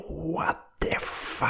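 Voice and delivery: breathy, slow, stunned swear; shocked gasped curse. Retold or imitated. Finding a spider in his fingers, a stunned breathy curse. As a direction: gasp it out in disbelief. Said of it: "Y yo".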